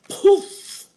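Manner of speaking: high, loud puff of a spell; sudden airy "poof" sound effect; magic poof. Acted. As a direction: pop it out like a spell going off.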